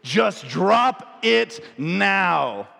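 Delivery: mother switches to a stern cop voice with her son. Retold or imitated. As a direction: order it like a cop talking down a suspect. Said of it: "does that cop voice".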